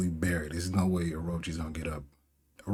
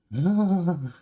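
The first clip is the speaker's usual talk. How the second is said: high heavy sigh; 9 semitones higher, 4 dB louder than usual. Acted. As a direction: let out a long weary sigh. Raizo before his speech.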